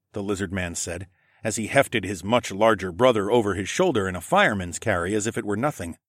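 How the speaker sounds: ordinary delivery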